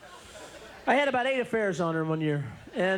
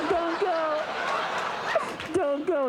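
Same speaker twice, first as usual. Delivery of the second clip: higher, tearful begging; pleading girlfriend; 8 semitones higher, slower than usual. Retold or imitated. Crying girlfriend begging him not to leave, higher tearful plea. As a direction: beg him to stay.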